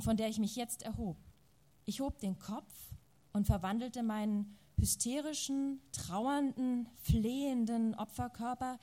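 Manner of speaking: measured literary reading to an audience; story narration. A narrator's reading. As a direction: read the novel aloud, letting the scenes carry. Literary reading after introduction.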